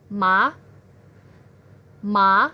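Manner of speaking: ma with a rising question-like pitch; second tone. Shown as a demonstration. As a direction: lift ma from below upward. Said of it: "dari bawah naik ke atas".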